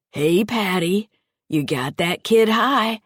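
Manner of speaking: lightly voiced sing-song taunt, a bit slow; weird sing-songy. Stoned foster father teasing Patty, weird sing-song lilt. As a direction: tease it out in a lilting sing-song. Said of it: "in a weird sing-songy voice".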